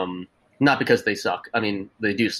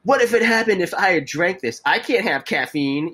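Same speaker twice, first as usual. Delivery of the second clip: angry customer; 7 semitones higher than usual. Retold or imitated. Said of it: "And then she got really angry".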